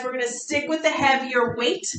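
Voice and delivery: ordinary talk, nothing marked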